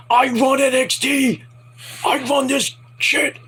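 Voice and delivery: high, drawn-out mock yell; imitated yelling. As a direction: act out a wrestler's slow boastful yell. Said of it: "he starts yelling at Grace".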